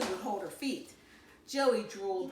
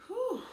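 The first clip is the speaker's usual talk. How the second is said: relieved whew; 13 semitones higher than usual. Acted. Relief after thinking he wet himself.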